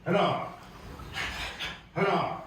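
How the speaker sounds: sharp barked count; count shout. Real, not acted. Instructor barking the count to students, loud. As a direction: call the count out to the class. Martial arts count called out.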